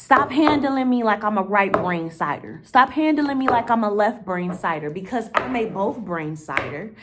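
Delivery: loud, insistent demand, pressing hard on each stop; exasperated outburst. Real, not acted. To people who treat her like one-sided, a loud exasperated rant.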